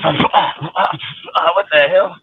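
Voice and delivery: very high, drawn-out mock shock. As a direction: stretch it out like a startled fool, mocking him. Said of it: "he is over there like this".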